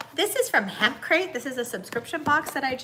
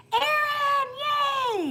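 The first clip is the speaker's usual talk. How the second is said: greeting a viewer in the chat with an excited call; excited callout; 13 semitones higher than usual. Real, not acted. Calling out a viewer's name.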